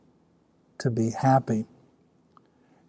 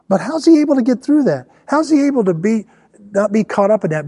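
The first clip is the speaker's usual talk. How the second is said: preaching loud; 7 semitones higher, 5 dB louder than usual. Acted. Preaching at full force.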